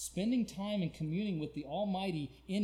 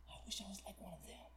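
quick, soft whispered wish; hushed acted yearning; wistful whisper; 2 semitones higher, 13 dB quieter, faster than usual. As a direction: whisper it longingly, as if to yourself. Acts psalmist's wistful wish.

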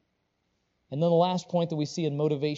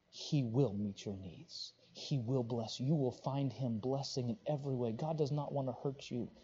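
softer, lower, slowed comforting words; quiet pastoral comfort; gentle reassurance; 3 semitones lower, 8 dB quieter than usual. Acted. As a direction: settle them down tenderly.

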